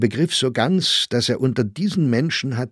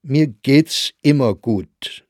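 slow, louder, mildly irritated; 5 semitones higher, 6 dB louder, slower than usual. A narrator's reading. Saxberger brushes off Grossinger's teasing, irritated. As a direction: clip it with annoyance. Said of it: "in etwas gereiztem Ton".

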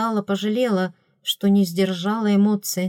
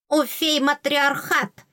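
higher, slow indignant bark; queen barks; 6 semitones higher, slower than usual. A narrator's reading. Fairy queen snaps at the girl, slow indignant bark. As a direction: snap it out, offended. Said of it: "рявкнула королева".

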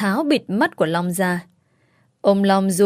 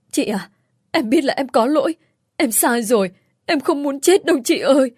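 higher, rising plea to be spared; terrified pleading; 5 semitones higher than usual. A narrator's reading. Uncle on his knees begs his sister to spare him, terrified pleading. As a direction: beg for your life from your sister.

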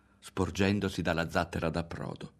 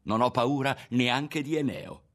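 high, raised protest; forceful, higher-pitched defiance; defiant shout; 6 semitones higher, 4 dB louder than usual. A narrator's reading. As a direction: insist loudly that nobody scares you. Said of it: "urlò di nuovo Ario".

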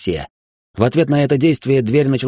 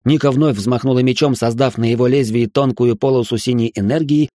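plain reading voice

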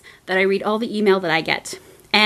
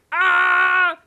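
1 little dragon yelp; 6 semitones higher than usual. Retold or imitated. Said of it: "He's just like".

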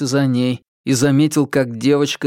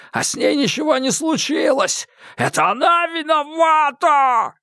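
crazed madman; 13 semitones higher than usual. A narrator's reading. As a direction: spit it out like a lunatic. Madman rants at Mark.

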